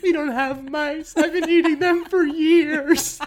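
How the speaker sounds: put-on kid confessing. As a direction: blurt it out like a guilty child. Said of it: "You were like".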